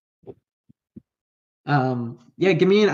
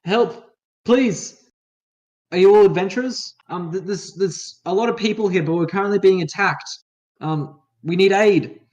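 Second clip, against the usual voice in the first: higher, louder plea for help; armored stranger calling down anxiously for aid; pleading survivor; 5 semitones higher, 4 dB louder than usual. Acted. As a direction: call for help from up high, pitched up.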